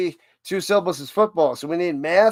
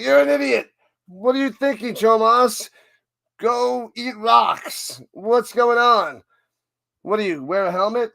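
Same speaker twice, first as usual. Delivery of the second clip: higher, hammed-up angry rant, the last lines dragged out slowly; mock insult rant; 4 semitones higher than usual. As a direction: mimic a rude chatter insulting someone. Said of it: "You don't be like".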